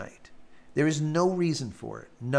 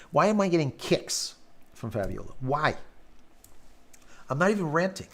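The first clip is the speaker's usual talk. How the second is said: usual speaking voice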